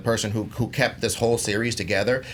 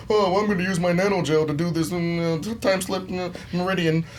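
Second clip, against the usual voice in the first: higher-pitched mock genius rambling technobabble; mock know-it-all; 6 semitones higher than usual. Panelist mocking an overly smart Iron Man, mock know-it-all. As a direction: parody a brainy hero improvising jargon.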